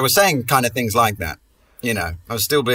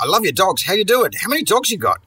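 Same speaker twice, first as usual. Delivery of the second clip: overeager cheerful gushing; 9 semitones higher than usual. Retold or imitated. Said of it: "I go like".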